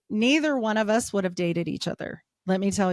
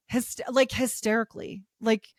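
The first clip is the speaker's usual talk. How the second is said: usual speaking voice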